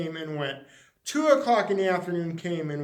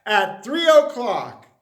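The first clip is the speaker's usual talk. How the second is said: loud, higher, slow invitation; grand announcement; 3 semitones higher, 7 dB louder, slower than usual. Acted. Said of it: "it said".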